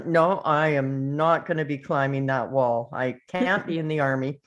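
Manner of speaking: usual speaking voice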